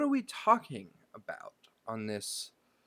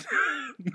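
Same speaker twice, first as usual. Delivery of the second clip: witch cackle; 3 dB louder than usual. Pretend. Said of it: "Is that good?".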